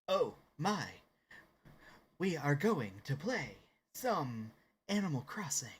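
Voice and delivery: halting starship captain delivery, broken by pauses; Captain Kirk. Acted. Streamer announces the game to viewers as Captain Kirk, halting impression. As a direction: announce it like a dramatic captain, phrase by phrase. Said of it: "To also be Captain Kirk".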